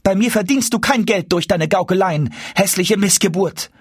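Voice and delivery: high-pitched, wrathful abuse; angry scolding. A narrator's reading. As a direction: berate him in a high, cross voice. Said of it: "entgegnete Frau Hanne zürnend". (zürnend, mit hoher Stimme geschimpft)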